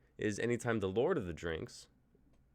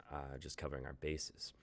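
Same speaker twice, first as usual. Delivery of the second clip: ordinary talk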